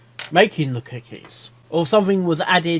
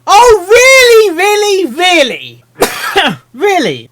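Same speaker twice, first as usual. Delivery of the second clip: loud, much higher "really?" cries; exasperated; 13 semitones higher, 11 dB louder, slower than usual. Real, not acted. Reader answers the protesting filly, loud exasperation. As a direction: throw up your hands, loud and high.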